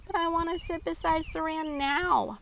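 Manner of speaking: high-pitched pouty insistence; pouting. A narrator's reading. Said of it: "Maxie pouted".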